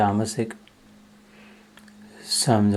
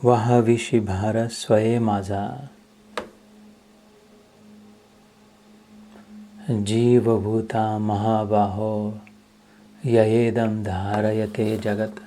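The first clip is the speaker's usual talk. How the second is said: slow, measured devotional recital; verse recitation; slower than usual. Acted. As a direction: recite the sacred verse, drawing out each word. Recites abhang and shloka verses. (संथ लयीत अभंग-श्लोक पठण)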